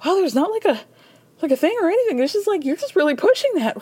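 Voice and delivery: high, breathless impression of Lisa; lightly imitating a wide-eyed housewife; mock Lisa voice. Podcaster mimicking Lisa to the boat captain, high. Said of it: "She's like".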